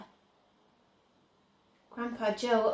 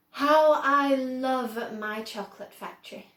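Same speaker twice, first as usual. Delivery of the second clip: lightly voiced eccentric chocolatier, louder; a touch of proud delight in his factory; Mr Wonka voice; 3 semitones higher, 7 dB louder than usual. A narrator's reading. Wonka gazing down over his factory, fondly proud.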